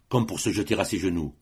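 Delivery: usual speaking voice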